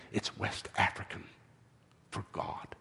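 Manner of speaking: low whisper, with a pause; hushed reveal. A narrator's reading. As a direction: reveal it softly, sinking to a whisper. Old man reveals the meaning.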